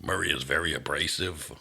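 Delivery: rough horrible clown growl; Murray clown voice. Shown as a demonstration. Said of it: "This is Murray's voice".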